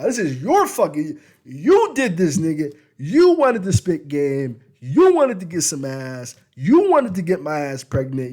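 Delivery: loud, high accusing rant; angry yelling woman. Retold or imitated. Wife blaming him for the pregnancy, yelling. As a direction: blame him hard, full voice.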